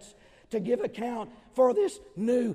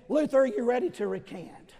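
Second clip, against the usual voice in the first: stern challenge; 5 semitones higher, 6 dB louder than usual. Acted. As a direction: challenge him to recant. Eck challenging Luther.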